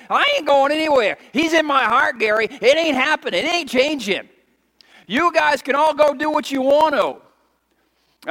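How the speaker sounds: preacher vowing to stay; defiant preaching. Acted. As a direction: plant your feet and refuse to budge. Preaching at full force.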